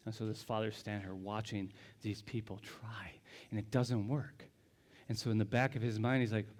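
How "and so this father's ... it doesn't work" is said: hushed whisper. Real, not acted. Preacher to congregation, hushed whisper. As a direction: whisper the failure, low and breathless.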